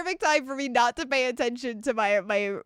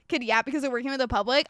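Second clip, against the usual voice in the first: normal talking voice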